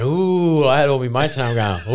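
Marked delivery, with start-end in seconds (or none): 0.0-0.6 drawn-out, high, loud ooh; imitated chorus of kids, raised and loud; kids' teasing ooh. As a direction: mimic a schoolroom of kids going ooh. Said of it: "all the kids going".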